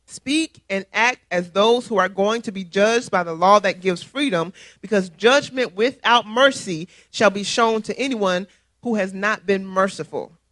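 normal talking voice

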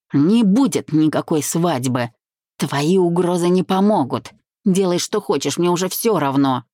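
plain reading voice

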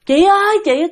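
hồn bé trai gọi. Retold or imitated. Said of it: "cứ kêu tên của bạn". (giọng hồn bé trai gọi chị, cao giọng)

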